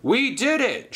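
high, jubilant cry; triumphant shout. Acted. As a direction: celebrate out loud. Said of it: "shouted Sir Topham Hatt".

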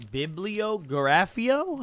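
Bibliografio said slowly and high, syllable by syllable; careful pronunciation. Shown as a demonstration. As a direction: sound out Bibliografio slowly on a rising pitch. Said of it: "how do I say that?".

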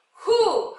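"후" with an audible H, loud and drawn out; H sound demo. Shown as a demonstration. Teacher showing when WH sounds like H, stressed and drawn out. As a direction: blow a strong H into "후". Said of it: "이럴 때는 H 소리가 나죠".

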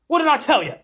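put-on high, loud boyish reproach; told-you-so jibe. A narrator's reading. Edmund's look at Peter put into words, loud reproach. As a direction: throw it at him as an accusation.

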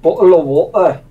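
Scottish-style "Bottle of water"; Scottish accent. Shown as a demonstration. Gamer showing how Scotland says it, Scottish accent. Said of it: "we say bottle of water".

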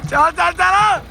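high, loud shouts urging on; hearty loud trucker banter. Real, not acted. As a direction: yell it out to the driver. Urging tractor driver on.

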